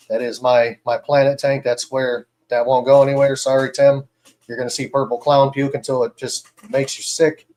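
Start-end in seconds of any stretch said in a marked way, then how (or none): none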